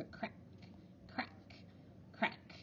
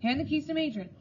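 louder, higher, booming orders; angry headmaster; 5 semitones higher, 6 dB louder than usual. A narrator's reading. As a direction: lay down the punishment in anger. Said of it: "he thundered".

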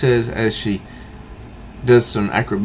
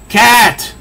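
loud high call of a name; 12 semitones higher, 7 dB louder than usual. Retold or imitated. Batman shouts after Catwoman, a loud high call. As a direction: shout her name after her as she goes.

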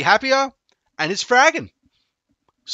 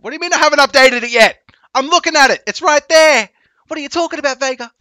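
higher and louder, incredulous; raised, exasperated disbelief; indignant protest; 10 semitones higher, 9 dB louder than usual. Real, not acted. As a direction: push back hard on the claim. Incredulous retort to Vega.

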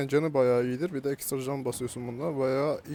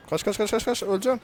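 panicked shout; 6 semitones higher, 6 dB louder than usual. Real, not acted. Fleeing, about to die.

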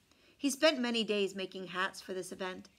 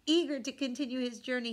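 usual speaking voice

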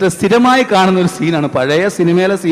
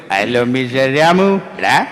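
slow, lower menacing villain greeting; villain Janardhanan; 3 semitones lower, slower than usual. Retold or imitated. Villain greeting the hero, slow mild menace. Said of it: "വേറൊരു ടോണായിരുന്നു".

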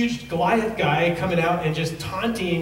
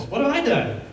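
indignant protest; 9 semitones higher than usual. Retold or imitated. David protesting to brothers.

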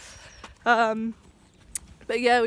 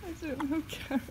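talking to a horse; 3 semitones higher, 5 dB quieter than usual. Real, not acted. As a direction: coo gently at the horse.

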